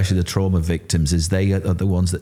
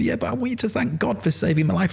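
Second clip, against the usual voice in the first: tearful plea; 11 semitones higher than usual. Retold or imitated. Pleading tearfully with chaplain.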